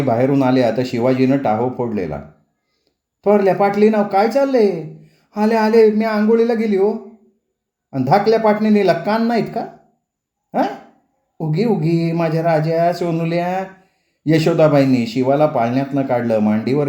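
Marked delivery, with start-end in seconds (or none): 3.2-10.7 higher, sharp scolding; scolding mother-in-law. Acted. Mother-in-law scolds daughters-in-law for ignoring baby, angry. 11.4-13.6 put-on soothing a baby. Grandmother hushes the crying baby, slow and tender.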